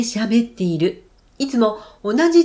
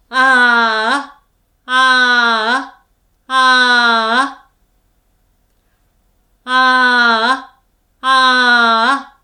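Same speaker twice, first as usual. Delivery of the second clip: long, louder ahs held at an easy pitch; sustained ah; 5 dB louder, much slower than usual. Shown as a demonstration. Teacher leading the viewers' warm-up, loud and steady. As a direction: lead the class in steady, projected ahs. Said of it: "では、ご一緒に".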